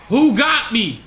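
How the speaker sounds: shouting. Real, not acted. Killed in game.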